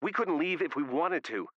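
heated accusing outburst; on the attack. A narrator's reading. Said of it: "Back on the attack".

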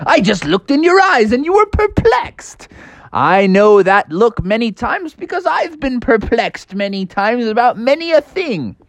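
high, wise rabbit gentleman; bunny gentleman voice. Acted. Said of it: "And he's like".

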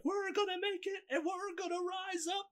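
very high mock speechmaker, climbing in pitch; rising convention intonation. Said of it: "increasing in intonation".

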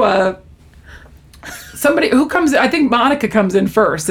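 normal talking voice

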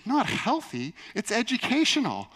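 high-pitched, mock-scandalized roommate; incredulous objection. Roommate defending his channel to a refusing friend, incredulous. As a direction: imitate someone protesting in disbelief.

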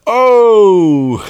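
long, high, loud "oh!"; crowd yell. Retold or imitated. Host imitating bar crowd at a torn-off arm, loud drawn-out yell. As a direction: holler like a whole shocked crowd. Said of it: "the crowd yells when he does it".

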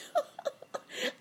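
high-pitched merry giggle; cricket laughing. A narrator's reading. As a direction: burst of squeaky cricket laughter. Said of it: "I'm going to laugh once more".

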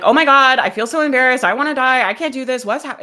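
loud, frantic embarrassed panic; mock panic. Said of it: "Versus like".

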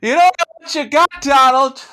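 high, loud mock lament; melodramatic shout. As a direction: wail it like a heartbroken drama queen. Mock melodrama about Daisy.

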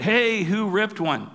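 drawn-out, half-held-back yell; retold yell. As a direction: imitate a kid calling out across camp. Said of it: "somebody yells out".

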